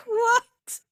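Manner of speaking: high, hammed-up disbelief; retold shock. Driver hearing Monday, high retold shock. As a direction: squeal it in mock dismay.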